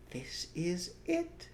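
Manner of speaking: high, slow mystery reveal; excited cry. A narrator's reading. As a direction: tell them the treasure is here. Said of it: "cried Mister Fox".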